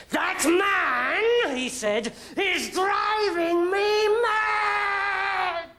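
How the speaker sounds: high slow shout of a king losing his mind; furious king shout. A narrator's reading. Mad king roaring that David drives him mad. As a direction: scream it out, at the end of his rope.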